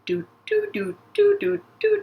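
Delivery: high, bouncy "doo-doo" footsteps; little sung steps in a high voice; tiptoeing doo-doos, pitched high; walking sound effect. Pretend. Voicing the character's walking steps.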